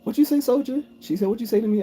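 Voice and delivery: high, sharp mock interrogation; female drill sergeant. Drill sergeant demands what soldier said, high mock challenge. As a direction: retell the sergeant pouncing on a soldier.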